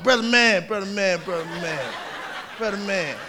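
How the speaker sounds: soft, low pleading brother man; lightly voiced wheedling hustler; begging window washer. A hustler sweet-talking a driver at the pump, softly pleading.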